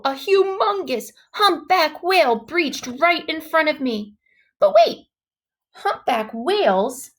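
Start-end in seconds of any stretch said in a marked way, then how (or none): 4.6-5.1 high, loud stop-short exclaim; sudden realisation. A narrator's reading. Narrator realising humpbacks don't eat killer whales, high surprised exclaim. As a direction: catch yourself mid-thought.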